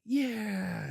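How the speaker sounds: high, eager little yeah, playing the kid; squeaky excited agreement. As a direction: chime in like a thrilled child. Daughter agrees, excited about plan.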